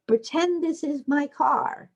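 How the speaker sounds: put-on high, bright little-kid voice; high child voice claiming a toy car; child's voice. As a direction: play a preschooler setting up a game. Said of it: "children use talk to establish the play frame".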